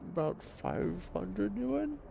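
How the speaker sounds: put-on old man voice. GM voices Shorty.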